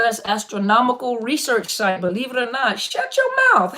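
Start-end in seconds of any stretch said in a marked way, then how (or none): 2.8-3.7 squealed high in mock shock; playful disbelief. Real, not acted. To a viewer streaming from Antarctica, amazed. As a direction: gasp it out, you can't believe it. Surprised reaction to viewer's location.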